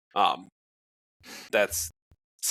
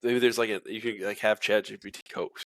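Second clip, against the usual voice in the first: ordinary delivery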